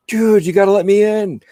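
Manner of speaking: loud, high begging yell; retold pleading shout. Retold plea to join show.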